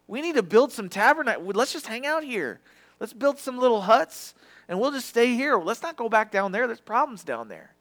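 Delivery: eager, high-pitched and loud chatter; Peter. Retold or imitated. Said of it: "of course he has to talk 'cause he's like me".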